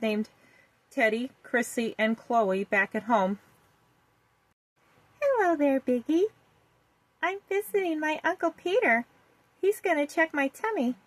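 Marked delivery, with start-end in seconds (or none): 5.2-11.0 put-on little girl voice. A narrator's reading. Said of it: "Vicky said to her".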